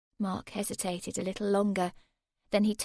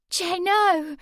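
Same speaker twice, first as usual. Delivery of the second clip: high, loud cry of protest; cried out; 10 semitones higher, 8 dB louder than usual. A narrator's reading. Girl cries out to Jay to stop. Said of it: "Sammy cried".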